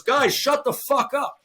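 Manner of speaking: high, drawn-out call for silence; retold exasperated order. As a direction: imitate a director scolding the crew. Director silencing a laughing crew.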